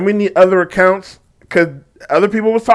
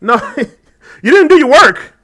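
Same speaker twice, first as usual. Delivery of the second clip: slow, loud and laughing; amused higher-pitched rebuke; laughing retort; 6 semitones higher, 4 dB louder, slower than usual. Real, not acted. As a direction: scoff it at her through a laugh. Reacting to the student.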